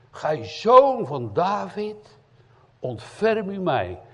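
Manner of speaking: loud slow cry for mercy. Acted. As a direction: call out across the crowd, slowly. Blind man cries out to Jesus.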